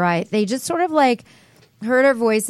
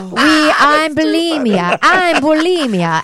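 slow, drawn-out comic Midwestern voice, a bit higher; Bulimia character voice; 5 semitones higher, 4 dB louder, slower than usual. Acted. Actress introducing her web sketch character, slow comic voice. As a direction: savor each word like a dim cheerful cousin. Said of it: "I actually got that voice".

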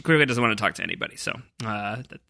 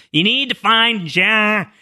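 slow, higher-pitched cartoonish impersonation; Ana Lucia; 8 semitones higher, slower than usual. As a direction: drag the words out in a mock apparition voice. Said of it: "that was how she says".

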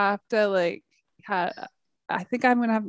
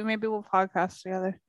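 normal talking voice

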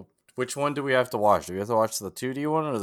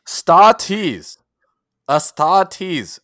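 put-on loud, slow old announcer voice; "Star Tees" like an old-time announcer; radio show voice; 7 semitones higher, 9 dB louder, much slower than usual. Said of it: "Why do you always default to that?".

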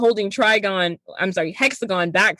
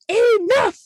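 much higher, louder mock "Enough!"; demon roar; 14 semitones higher, 3 dB louder than usual. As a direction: bellow it like an enraged demon. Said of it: "he's like".

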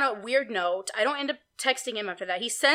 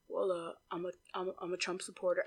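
very soft, low, stammered confession; low, halting mock mumble; imitated hushed talk; 6 semitones lower, 13 dB quieter, faster than usual. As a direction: confess it under your breath, imitating him. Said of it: "like, whispering".

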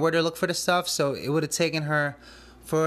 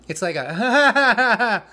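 a loud, high, slow burst of furious laughter; angry laugh-scream; 8 semitones higher, 8 dB louder, much slower than usual. Shown as a demonstration. Showing how he laughed at the boss's text, angry laugh-scream. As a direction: laugh like you're screaming at yourself. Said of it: "I angrily laughed, screamed".

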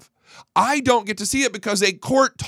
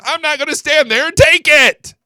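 angry outburst; 9 semitones higher, 6 dB louder than usual. Acted. Furious rant about the court.

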